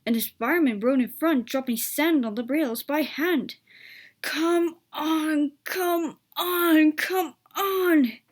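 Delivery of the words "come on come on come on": higher and louder each time, out of breath; breathless panting. A narrator's reading. Edward straining up the hill in the rain, breathless panting. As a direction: push out each come on between gasps, building. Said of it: "panted Edward breathlessly".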